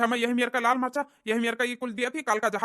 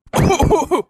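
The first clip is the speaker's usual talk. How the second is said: harsh loud cough; 8 semitones higher, 12 dB louder than usual. Acted. Old man coughing loudly before his friend.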